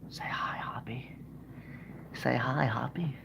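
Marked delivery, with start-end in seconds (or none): 0.1-1.1 whisper. Real, not acted. Whispering to puppet Hoppy.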